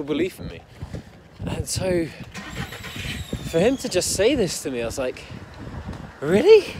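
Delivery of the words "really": high-pitched, exasperated disbelief; incredulous. Retold or imitated. Son who can't understand his dad's doubt, incredulous. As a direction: act out baffled indignation, drawing out the question.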